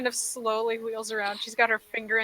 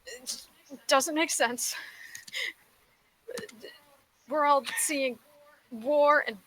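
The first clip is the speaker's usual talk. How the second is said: higher-pitched, halting exasperation; exasperated Plume; 4 semitones higher than usual. Acted. Plume recaps the dungeon to the party, exasperated. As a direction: recap it in pained, incredulous bursts. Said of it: "gets exasperated".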